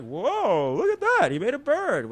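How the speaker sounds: high, drawn-out imitated crowd wonder; mock amazement. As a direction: gasp it like a wowed audience. Acting audience amazed at bird trick.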